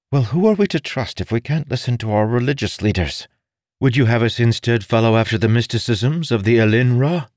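high, indignant female retort; exasperated lady arguing back; stern noblewoman. Acted. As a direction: challenge him with pointed questions.

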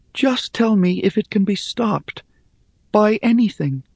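high, pleading voice; pleading Rhea. Acted. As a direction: beg for help, reaching up.

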